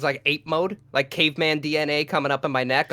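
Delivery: ordinary talk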